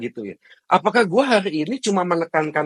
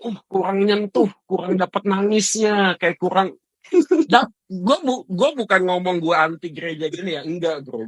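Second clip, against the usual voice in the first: ordinary talk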